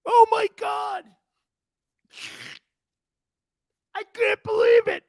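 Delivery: hammed-up sobbing pep talk, very high and slow; squealing, tearful mock locker-room rally cry; coach in tears. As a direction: imitate a coach crying and shouting to his team. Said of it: "Here's Marty in tears".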